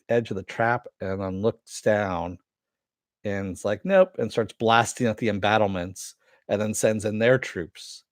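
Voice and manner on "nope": high, loud cartoon "Nope"; a walker smugly declining the bait; high, loud, jokey "Nope"; mock robot refusal. AT-ST refuses the trap.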